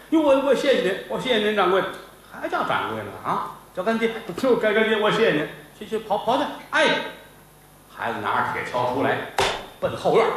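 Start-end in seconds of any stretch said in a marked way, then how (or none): 0.1-7.0 overjoyed thanks. Acted. Apprentice thanks him happily.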